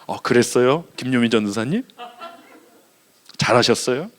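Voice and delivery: awkwardly put-on Seoul accent, low and soft; affected Seoul accent. Shown as a demonstration. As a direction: pose as a Seoul native with lilting question endings. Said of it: "서울 사람인 것처럼 위장하려고".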